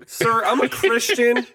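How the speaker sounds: high, slow, put-on innocence; mock-pious plea. Listener mimics the pious excuse.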